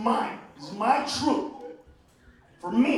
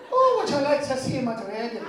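put-on Irish accent; 4 semitones higher, 7 dB louder than usual. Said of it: "if the fucker was Irish".

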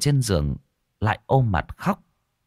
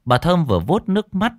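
ordinary delivery

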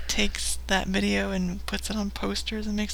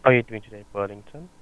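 call center agent; 11 semitones lower, 4 dB louder, much faster than usual. Acted. Prank call, plays sales agent.

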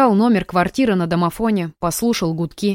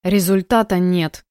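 ordinary delivery, nothing marked

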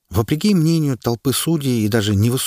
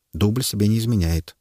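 ordinary delivery, nothing marked

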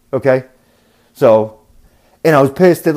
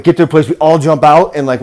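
ordinary talk, nothing marked

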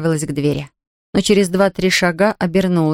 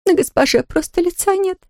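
sobbing; 12 semitones higher than usual. A narrator's reading. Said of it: "всхлипывая".

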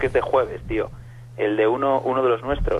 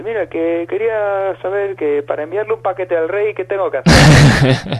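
higher, slow Basque caller voice, louder at the end; imitated Basque accent; 7 semitones higher, 7 dB louder, slower than usual. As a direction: drawl out a Basque woman's odd request. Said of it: "acento vasco y parecía fumada". (imita, aguda y lenta, a la vasca que llama)

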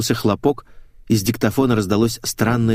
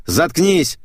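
high, forceful angry command; злой окрик; 8 semitones higher, 4 dB louder than usual. A narrator's reading. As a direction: silence her with a sharp cry. Повторный окрик перед шлепком. (резко и зло, высоким голосом)

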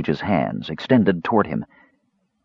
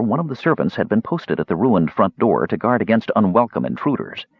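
plain reading voice

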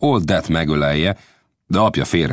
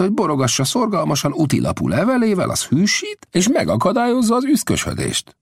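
put-on higher, sly old scout voice; an old scout feeling his way, pitched up; Old Death's voice; 8 semitones higher than usual. Old Death asks Lang's son about the smith.

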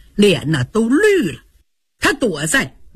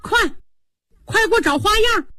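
higher, fast, hurried calling; calling out as Wang Xifeng; 10 semitones higher than usual. A narrator's reading. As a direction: call out to Ping'er in a rush. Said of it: "一边走一边喊".

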